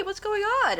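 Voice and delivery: ordinary talk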